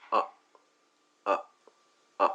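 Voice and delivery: very short, weak vowel আ; short schwa. Shown as a demonstration. Teacher showing learners the weak schwa sound, short আ. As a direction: say আ as a brief, clipped schwa. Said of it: "সাউন্ডটা এরকম হয়".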